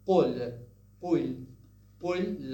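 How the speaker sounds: قل loud, with a uvular stop; Fusha pronunciation. Shown as a demonstration. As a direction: hit the Q of قل firmly, classical Arabic style. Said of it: "the Fusha way of saying it".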